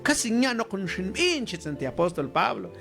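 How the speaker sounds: ordinary talk, nothing marked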